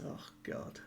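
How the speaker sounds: real breathy under-the-breath groan; a soft whispered oh god to yourself; whispered aside. Real, not acted. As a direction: drop to a whisper, embarrassed at the idea. Aside reacting to own silly theory.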